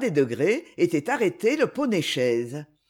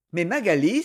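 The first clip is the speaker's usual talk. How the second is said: ordinary delivery, nothing marked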